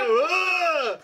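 high, far-off-sounding yelled "ah!"; sudden high-pitched comic shriek; mock startled scream. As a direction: cry out as if the lights just flipped. Acting out sudden light-switch reaction.

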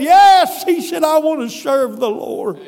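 loud, high cry of devotion; high, full-voiced retold declaration; exultant shout. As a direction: shout it out as the joyful believer. Joyful man's fervent answer, acted out.

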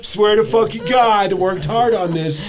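high, laughing mock scolding; mock angry partner. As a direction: play the furious wife, laughing.

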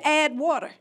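gentle, matter-of-fact divine answer; calm voice of God. Retold or imitated. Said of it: "He said".

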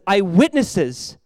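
eyewitnesses drawn out very slowly, each syllable weighed; stressed eyewitnesses. Shown as a demonstration. Preacher reading scripture to the church, slowly stressing eyewitnesses. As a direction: lean on eyewitnesses so the congregation catches it.

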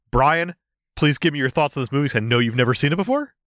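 ordinary talk

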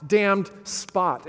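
a sleepwalker's slow, haunted cry; Lady Macbeth crying out. Acted. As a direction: drag each word out in torment. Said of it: "she's crying out".

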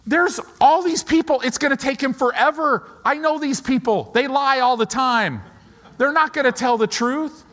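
high, loud exasperated complaint; agitated Jairus. Acted. Jairus protesting to himself about the crowd delaying Jesus, exasperated. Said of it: "And Jairus is going".

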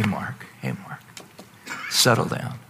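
low, very soft, whispered calming words; hushed voice. Acted. The Holy Spirit calming Mark from within, in a low hushed whisper. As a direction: hush him down gently, almost a whisper. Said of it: "The Holy Spirit's voice is quieter".

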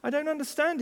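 high-pitched, bewildered complaint; hurt and confused. A narrator's reading. Colt complaining to his mother, hurt and confused. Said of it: "Hurt and confused".

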